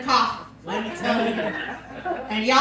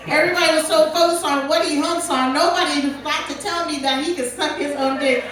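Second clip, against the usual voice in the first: normal talking voice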